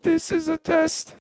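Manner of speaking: very high, robotic pitch-shifted voice. Shown as a demonstration. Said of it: "all the way up".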